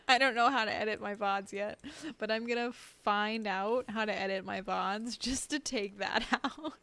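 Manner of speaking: loud, laughing exasperation; laughing embarrassment. Real, not acted. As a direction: insist loudly, breaking into a laugh. Laughing over embarrassing moment on stream.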